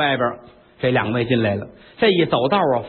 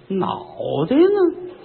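sudden much higher squeak on 袋呢; startled question; 7 semitones higher, slower than usual. Acted. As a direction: yelp it as the hand finds no head. 摸不到脑袋惊问.

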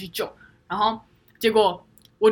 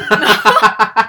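loud burst of laughter; cracking up; laughing; 13 dB louder than usual. Real, not acted. (爆笑)

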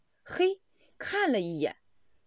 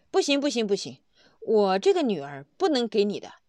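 shouting no, no, no, much louder; a father flatly refusing, raised voice; loud refusal; 7 dB louder, faster than usual. Retold or imitated. Father shouting his refusal at the bank manager.

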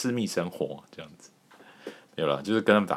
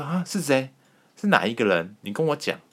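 eager questioning; 7 semitones higher than usual. Retold or imitated. Eager asking who likes him.

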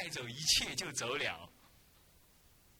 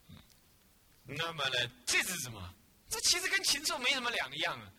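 usual speaking voice

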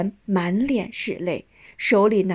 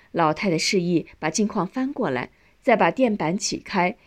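ordinary delivery

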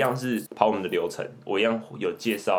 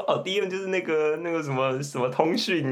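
put-on higher, a panicked kid scrambling for words; lightly acted flustered boy; 8 semitones higher than usual. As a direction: fumble like a caught kid. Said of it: "然后他就慌了".

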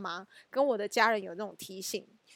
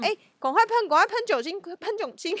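urgent nagging; 9 semitones higher, 3 dB louder than usual. Retold or imitated. Urging family to spray alcohol.

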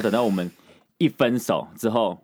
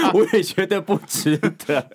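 laughing; 10 semitones higher, 6 dB louder, slower than usual. Real, not acted. Laughing at friend's teasing.

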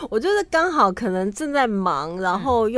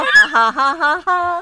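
laughing along; 5 semitones higher, 4 dB louder, slower than usual. Retold or imitated. Imitating the song's final laugh.

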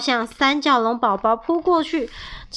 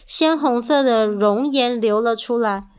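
ordinary delivery

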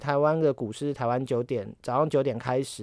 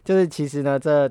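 ordinary talk, nothing marked

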